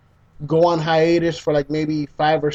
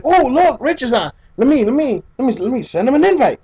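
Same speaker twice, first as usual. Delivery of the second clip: higher, quick eager chatter; retold eagerness; 7 semitones higher than usual. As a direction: spot a friend online and rush to invite him. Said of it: "and I'm like".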